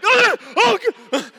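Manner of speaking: jolted half out of the seat; startled gasp. Retold or imitated. Husband reacting to wife's sudden yell, shocked.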